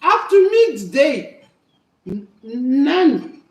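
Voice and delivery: plain reading voice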